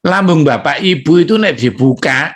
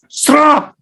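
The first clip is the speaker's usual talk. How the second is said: spray sound; 12 semitones higher than usual. Retold or imitated. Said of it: "Empedunya nyemprot".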